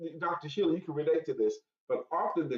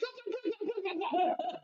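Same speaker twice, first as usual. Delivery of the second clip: squeaky laugh breaking up the impression; high-pitched laugh. Real, not acted. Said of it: "That was the whole impression".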